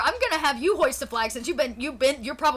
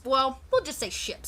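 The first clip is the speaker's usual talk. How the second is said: ordinary talk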